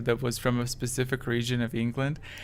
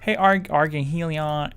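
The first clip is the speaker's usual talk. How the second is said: ordinary talk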